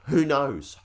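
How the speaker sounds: "Who knows?" thrown up high and loud with a falling end; exclaimed. Real, not acted. Wondering aloud to viewers about Villa's plans, loud rhetorical exclaim.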